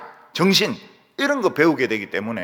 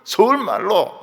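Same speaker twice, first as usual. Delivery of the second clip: Seoul speech; 8 semitones higher, 6 dB louder than usual. Retold or imitated. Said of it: "이 서울 사람들은".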